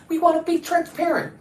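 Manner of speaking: high-pitched mock of officials; mocking imitation. Imitating officials who kept promising transparency, mocking high voice. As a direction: parrot their promise in a put-on high voice. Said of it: "That's what they kept saying".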